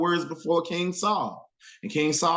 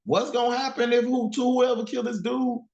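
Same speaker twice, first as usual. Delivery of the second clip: higher, curious slang question; eager street question; 8 semitones higher than usual. Retold or imitated. David asking the soldiers about the reward, higher and eager. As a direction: ask around like a kid sniffing out a deal. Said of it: "David started asking".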